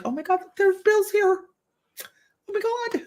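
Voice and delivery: high-pitched mock gasp of discovery; excited realisation. Telling viewers how spotting Bill's sign felt, excited realisation.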